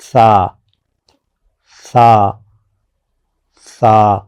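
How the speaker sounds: سا said gently, with no whistle on the s; soft sa. Shown as a demonstration. Teacher modelling a gentle سا without any hiss. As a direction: let سا out easily, the s soft and unhissed. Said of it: "بہت نرمی سے، بہت آرام سے نکالیں".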